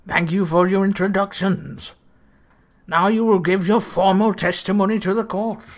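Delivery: judge voice. Acted. Judge voiced in court scene.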